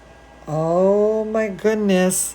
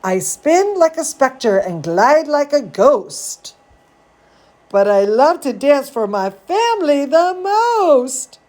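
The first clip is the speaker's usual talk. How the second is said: lively storybook read, a bit higher; reading to children; 5 semitones higher than usual. A narrator's reading. Reading Zambolina to kids, lively storybook voice. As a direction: read it like a bedtime story for little ones. Reading picture book aloud.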